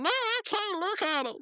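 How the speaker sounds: very high cartoon wail; pleading refusal. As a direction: refuse in a squealed mock plea. Rigby refuses to watch the tape.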